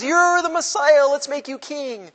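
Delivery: high, mock-jubilant crowd cry; hammed-up high proclamation; excited crowd acclaim. Everybody acclaims him.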